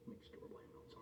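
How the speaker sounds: breathy whisper to the pet. Real, not acted. Owner whispering about his hamster's noises. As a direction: whisper it so the hamster stays calm. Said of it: "unvoiced whisper".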